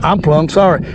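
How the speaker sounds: usual speaking voice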